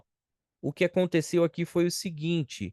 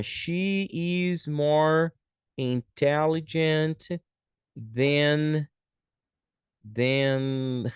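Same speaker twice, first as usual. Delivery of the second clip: very slow, stretched words with pauses; slow dictation; much slower than usual. Shown as a demonstration. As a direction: dictate it word by word. Teacher writing out example sentence.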